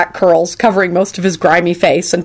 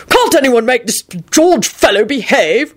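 higher-pitched exasperated holler; loud holler; 5 semitones higher than usual. A narrator's reading. Richard complaining about the corpse at dinner, hollering. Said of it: "Richard hollered loudly".

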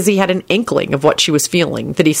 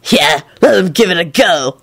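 smug cocky reply. A narrator's reading. Backing his mate's boast against the officers, smug. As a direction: answer with smug bravado. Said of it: "Lupin replied smugly".

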